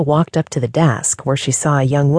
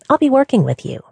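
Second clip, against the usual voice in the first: cheery receptionist voice; 10 semitones higher than usual. A narrator's reading. Sandra's high voice.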